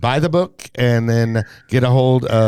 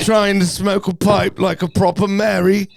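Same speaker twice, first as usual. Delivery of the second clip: higher sing-song mock English accent, laughing; 8 semitones higher than usual. Host performing his English accent on request in a sing-song voice. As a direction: camp it up in a posh English accent. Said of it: "I'm gonna go into my English accent".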